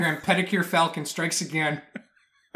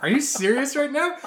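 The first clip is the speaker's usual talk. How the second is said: high, loud incredulous question; mock indignant; 10 semitones higher, 6 dB louder than usual. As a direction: play him exasperated at her. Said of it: "I was like".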